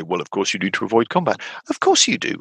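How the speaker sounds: usual speaking voice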